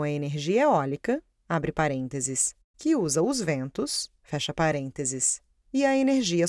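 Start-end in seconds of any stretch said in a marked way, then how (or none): 1.5-2.5 low, slow aside voice; dictating. Acted. Reads the brackets aloud. 4.2-5.4 dictating. Acted. Reads the brackets aloud.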